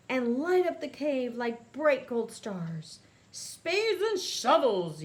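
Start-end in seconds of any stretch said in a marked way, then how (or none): 3.3-5.0 slow, loud mole-villain oath; villain's yell. A narrator's reading. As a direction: bellow it as a startled monster mole. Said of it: "yells Odious".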